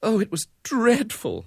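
high, plaintive lament; high-pitched woe; moaning distress. A narrator's reading. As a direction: moan about the social disgrace. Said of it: "moaned Missus Brown".

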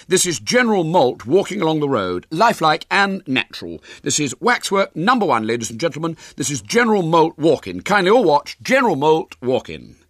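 high, loud showman's patter; big, high-pitched ladies-and-gentlemen voice; young showman William. Acted. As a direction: announce it proudly like a fairground barker. William presents waxwork show.

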